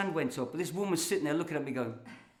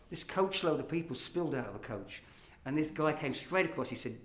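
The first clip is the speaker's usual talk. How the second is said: ordinary talk, nothing marked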